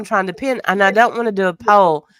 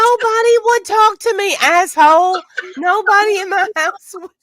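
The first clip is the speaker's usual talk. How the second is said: loud, high-pitched comic complaint; mock wail; 13 semitones higher, 5 dB louder than usual. Sick friend protesting nobody would talk to her, mock wail.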